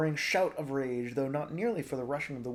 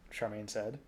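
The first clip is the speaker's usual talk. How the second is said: ordinary delivery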